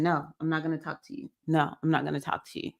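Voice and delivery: hushed and hurried, turning the voices away; low-volume, quick no, no, no; quiet, rapid refusal. Retold or imitated. Man in the movie refusing his voices, quiet and fast. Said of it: "he starts saying".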